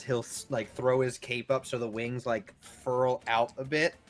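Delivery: ordinary talk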